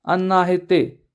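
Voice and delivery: ordinary delivery, nothing marked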